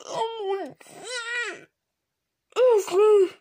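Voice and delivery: high, drawn-out sob of joy; crying with happiness; joyful sobbing cry. Real, not acted. Holding the rare card, sobbing with joy.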